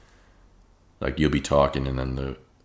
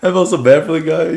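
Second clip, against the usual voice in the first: high, loud laughing talk; laughing hard; 13 dB louder, slower than usual. Real, not acted. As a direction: squeal through the laughter. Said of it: "I couldn't stop laughing".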